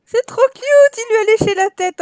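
high, loud delighted squeal; gushing over cuteness. Real, not acted. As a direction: squeal at something adorable. Adorable puppy licks baby. (cri aigu attendri)

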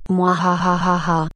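slow theatrical villain cackle; evil laugh. Acted. Villain laughs at his plan.

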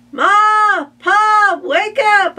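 very high, loud wake-up scream. Acted. Child screaming to wake Ma and Pa at night, very high loud. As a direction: shriek to wake the house. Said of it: "I screamed".

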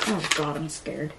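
ordinary talk, nothing marked

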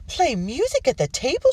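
high, loud affronted wife; indignant exclamation. A narrator's reading. Wife to husband who would play music at dinner, high indignant exclamation. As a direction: exclaim it loudly in scandalised disbelief. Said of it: "she exclaimed".

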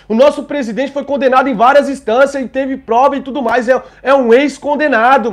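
loud, high-pitched furious rant; real outrage. Real, not acted. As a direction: shout your indignation at the whole country. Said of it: "Tô revoltado".

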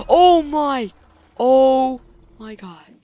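high, loud scared cry; frightened shriek. Real, not acted. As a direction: scream it in sudden fright. Sudden scare in horror game.

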